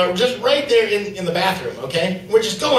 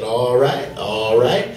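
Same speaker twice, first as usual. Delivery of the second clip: much lower, deep voice drawl; Michael Clarke Duncan impression; 7 semitones lower, slower than usual. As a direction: go deep and slow like a big gentle giant. Coworker Lester quoted again.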